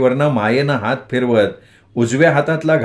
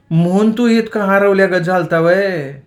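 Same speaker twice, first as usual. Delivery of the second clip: amused; 7 semitones higher than usual. A narrator's reading. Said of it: "मनाशी हसल्यासारखी हसली".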